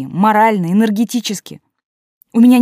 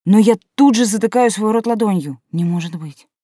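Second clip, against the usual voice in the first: ordinary delivery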